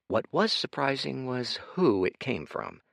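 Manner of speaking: usual speaking voice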